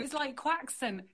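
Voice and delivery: QUAXON, "kw" and a hard x in the middle; mispronounced croissant. Shown as a demonstration. Showing viewers the wrong way, a mock mispronounced QUAXON. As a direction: say QUAXON with a "kwak-sun" sound. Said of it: "it's not quaxon".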